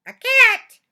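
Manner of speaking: high, loud startled squeak; surprised mouse. Acted. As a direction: gasp it out like a tiny mouse. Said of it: "the mouse asked".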